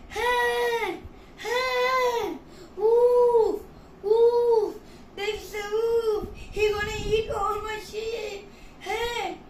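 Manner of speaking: high, drawn-out calls of alarm; shouted cry for help. Acted. Shepherd boy calls the villagers about a wolf, shouting. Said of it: "started to shout".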